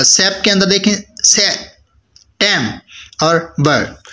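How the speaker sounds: से, टेम and बर separated by clear pauses; isolated syllables. Shown as a demonstration. Teacher showing one vowel sound each, से, टेम, बर said apart. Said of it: "single vowel sound".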